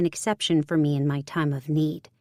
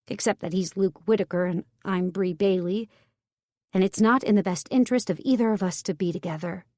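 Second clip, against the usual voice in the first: ordinary delivery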